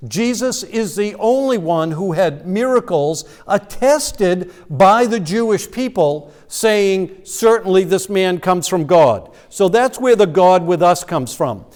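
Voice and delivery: ordinary delivery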